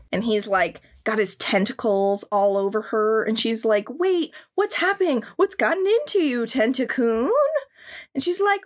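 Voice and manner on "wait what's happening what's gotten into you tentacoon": high, put-on girly alarm; high mock girl voice, startled; mock anime girl. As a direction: play a startled cartoon heroine. Voicing the girl in the hentai.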